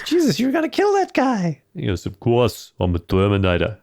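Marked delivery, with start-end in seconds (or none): 0.0-1.6 very high squeaky mock alarm; broken adolescent voice. Host imitates young John scolding the Terminator, broken voice. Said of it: "His voice is so broken". 2.0-3.8 very Australian attempt at an Austrian accent; lightly botched Austrian accent sliding into Aussie; Arnie. Host imitates the Terminator answering John, Aussie-Austrian accent. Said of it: "That was the Aussiest Arnie".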